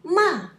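ma falling sharply from high, loud; fourth tone. Shown as a demonstration. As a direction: drop ma hard from the top. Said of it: "It's different tones".

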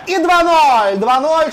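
loud, high goal shout; excited goal call. Real, not acted. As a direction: belt out the score. Goal call in hockey commentary.

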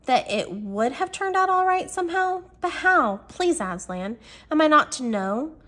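high, tentative pleading; lightly acted high-pitched plea; faint questioning. A narrator's reading. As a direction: ask it in a high, uncertain voice. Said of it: "said Lucy rather faintly".

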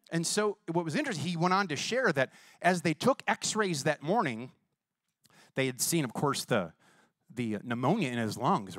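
usual speaking voice

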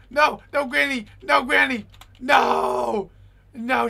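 loud panicked screams of no; terrified shouting at Granny; panicked yelling. Real, not acted. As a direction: yell as the granny chases you. Fleeing Granny in horror game.

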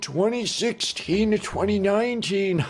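put-on creaky old-timer mourning his homie; old man voice. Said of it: "I don't know why I'm giving him an old man voice".

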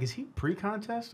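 high, slow, puzzled self-quote; wondering aloud. Retold or imitated. Telling the hosts how he sized up the pickup, high and puzzled. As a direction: act out your own confused thought, drawn out. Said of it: "I'm like".

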